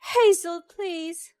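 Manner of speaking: clinging to the last hope, pleading; desperate begging. Acted. Mother-in-law on her knees begging Hazel, desperate high plea. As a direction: cry her name out high and loud, then drop to a plea.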